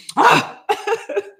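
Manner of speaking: sudden high burst of laughter. Real, not acted. Speaker admitting slowing down is hard, high laugh.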